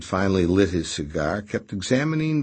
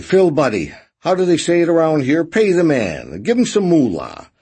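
hammed-up jovial boss, higher and louder; theatrical; 10 semitones higher, 8 dB louder than usual. A narrator's reading. Raspy ordering Boney to pay, theatrically. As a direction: play it big for the room. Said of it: "He sighed theatrically".

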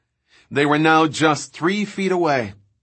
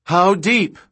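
high, loud demand rising at the end; raised, urgent question; insistent shouted question; 6 semitones higher, 5 dB louder than usual. A narrator's reading. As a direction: shout it, pressing for an answer. Repeats unanswered question more urgently.

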